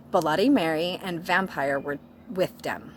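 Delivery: ordinary delivery, nothing marked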